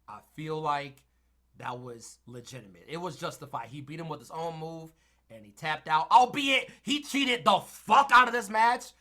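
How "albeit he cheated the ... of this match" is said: loud, high burst of outrage; excited outburst. Real, not acted. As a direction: blurt it out, fired up. Worked-up wrestling rant.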